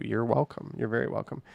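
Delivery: ordinary talk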